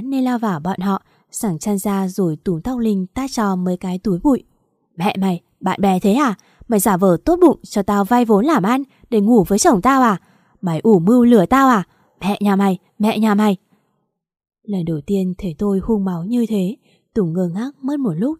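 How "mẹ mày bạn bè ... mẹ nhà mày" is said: louder enraged accusation; furious yelling. Acted. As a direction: tear into her, spitting fury. Wife beating her betraying friend.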